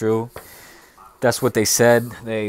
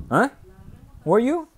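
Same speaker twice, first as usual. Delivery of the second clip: voiced surprised boss; 13 semitones higher, 4 dB louder than usual. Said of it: "that little scene".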